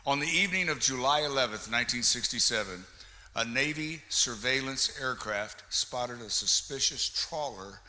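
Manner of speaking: ordinary talk, nothing marked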